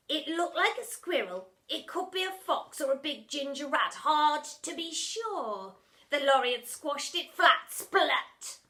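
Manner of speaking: 1 dinner lady voice. Acted. Splat voiced describing roadkill.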